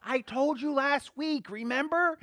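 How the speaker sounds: a playful, slow, high answer in a hammed-up voice; mock Jesus reply. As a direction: tease Peter as Jesus, amused.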